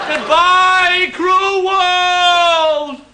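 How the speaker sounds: high, loud melodramatic farewell; hammed-up suicidal jumper. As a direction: wail it from the ledge like a ham. Jumper on the ledge.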